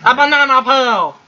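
put-on high kid voice; Timmy voice. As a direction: pitch up into a little boy. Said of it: "It's my Timmy voice".

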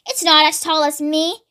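usual speaking voice

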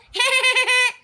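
bleating like a goat; high goat "meeeh". Acted. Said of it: "that's my goat impression".

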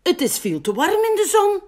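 high, loud, quick sheep voice; urging sheep. A narrator's reading. Schaap is too warm in the sun, high urgent complaint. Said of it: "zegt Schaap".

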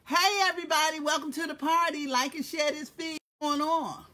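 loud high party greeting; excited welcome. Real, not acted. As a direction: welcome everyone with energy. Greeting viewers.

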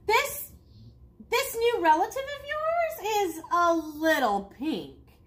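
high, haughty raccoon voice; put-on snooty animal voice; raccoon character.

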